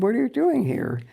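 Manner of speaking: high, loud startled "what are you doing here"; shocked to find him home midday; alarmed question. Retold or imitated. Wife startled to see husband home in the afternoon, high alarmed question.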